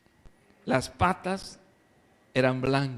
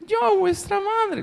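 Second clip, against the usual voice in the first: very high, slow sweetened voice; wolf's fine voice; slower than usual. Acted. Wolf at the door imitating the mother in a high, slow fine voice. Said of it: "el lobo le había cambiado la voz".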